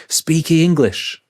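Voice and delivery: loud, high, slow shout across the room; a man shouting for tea across the room. Retold or imitated. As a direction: call it across the room, drawn out. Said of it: "he shouted".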